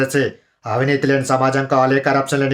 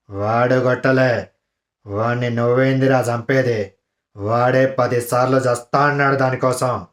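Gopichand villain voice; 4 semitones lower, 3 dB quieter than usual. Retold or imitated. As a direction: growl it out like the Varsham villain. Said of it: "Gopichand గారి voice ... villain character".

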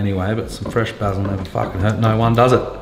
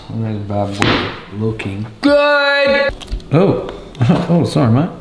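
ordinary talk

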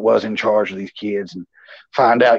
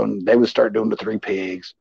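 ordinary talk, nothing marked

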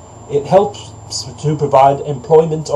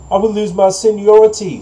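high-pitched cow's protest; cow refusing; 6 semitones higher, 4 dB louder than usual. A narrator's reading. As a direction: protest in a raised storybook voice.

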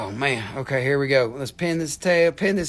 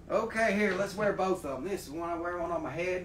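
usual speaking voice

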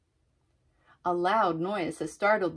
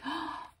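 quick, soft intake of breath; small storytime gasp of wonder; gasp of discovery; 8 semitones higher, 10 dB quieter than usual. Acted. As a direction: gasp as if spotting something sweet.

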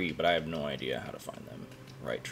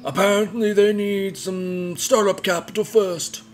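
put-on much higher, louder old man voice; 12 dB louder, slower than usual. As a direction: play the wise old shopkeeper. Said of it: "Now I gotta do the old man voice again".

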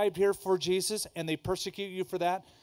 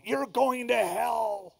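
slow, higher mock shout of a pier preacher; street preacher yell; 6 semitones higher, slower than usual. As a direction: bellow a condemnation at passers-by. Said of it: "who used to yell at people".

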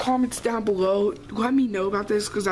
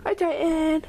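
high sweet voice for the dog; pet voice; 9 semitones higher than usual. Real, not acted. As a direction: coo hello to the dog, pitched way up. Greeting the dog.

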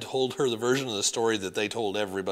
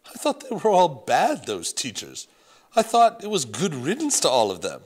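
louder, dismissive French grandmother; thick French accent; 3 semitones higher, 7 dB louder than usual. Acted. Grandmother dismissing the old teachers, French accent. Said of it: "Grandmere had a thick French accent".

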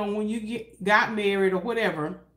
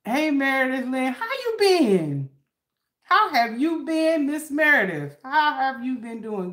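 excited greeting; 5 semitones higher than usual. Real, not acted.

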